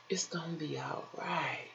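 breathy hush of comfort; whispered reassurance. Real, not acted. Whispering to a struggling viewer, gently reassuring.

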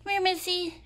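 high, loud, fast coaxing call; calling the cat. Real, not acted. Owner calling her cat Missy for a treat, high coaxing call. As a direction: sing out to the cat to come.

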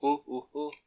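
evil chuckle. Acted. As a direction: laugh like a cartoon villain. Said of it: "C'est mon rire, euh, machiavélique".

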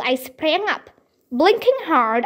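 ordinary delivery, nothing marked

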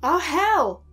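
loud alarmed yell; shout. A narrator's reading. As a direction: yell it in shock. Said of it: "Max shouted".

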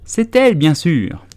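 high, loud pronouncement falling away; emphatic, high-pitched character voice; declaring dodo. A narrator's reading. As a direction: announce it loudly as the self-assured dodo. Dodo declares who gives prizes.

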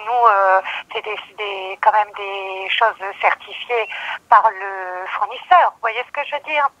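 usual speaking voice

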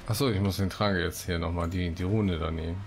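usual speaking voice

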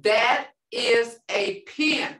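slow, careful that is a pen; slow demo. Shown as a demonstration. Teacher showing students a sentence, slow and careful. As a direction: spell that is a pen out slowly. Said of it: "Now look here".

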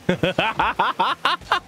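high, delighted cackle; burst of laughter. Real, not acted. Caster laughing at a whiffed shot, high cackle.

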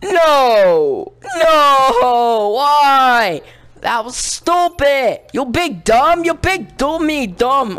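high, loud cries of disbelief; outraged yelling. Real, not acted. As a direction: wail in frustration at losing. Outraged at dying in the game.

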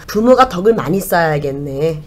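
normal talking voice

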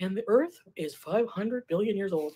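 slow, measured nature-documentary narrator; calm documentary narration. Shown as a demonstration. Explaining how documentary narrators talk, slow and calm. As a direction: narrate it slowly like a wildlife film. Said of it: "he talks very calm and very".

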